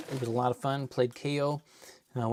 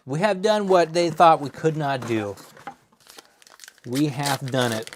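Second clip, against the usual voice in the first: triumphant; 5 dB louder than usual. Real, not acted. Triumphant declaration.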